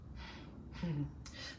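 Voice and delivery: quiet sinister laugh; a low breathy cackle; soft evil chuckle. Acted. The avenger chuckles darkly at the viewer. Character laughs after monologue.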